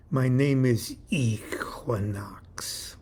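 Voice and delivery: put-on slow, hushed European-accented introduction; mysterious, whispery European accent; phony European accent. A narrator's reading. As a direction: draw the name out in a fake European accent. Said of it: "The phony accent suddenly disappeared".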